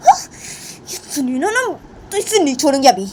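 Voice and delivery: put-on worked-up girl ranting in outrage; angry Simran. Simran fuming over clothes thrown on the floor, angry.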